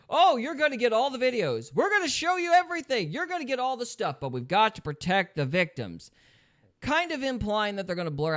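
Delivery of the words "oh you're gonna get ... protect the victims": mock eager promise, high-pitched; Pam Bondi. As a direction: pitch it up as an over-promising official. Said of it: "Pam Blondie's like".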